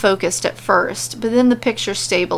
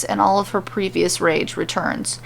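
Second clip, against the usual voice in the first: plain reading voice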